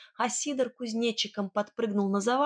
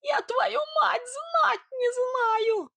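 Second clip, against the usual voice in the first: very high, slow squealed denial; piping, drawn-out protest; squeaking; 4 dB louder, much slower than usual. Acted. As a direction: squeal it out far above your own voice. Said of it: "пропищал Сидор".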